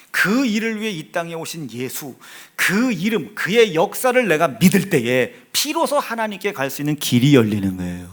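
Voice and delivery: loud, driving sermon delivery; insistent pulpit proclamation; forceful preaching. Acted. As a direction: preach it with force, punching key words.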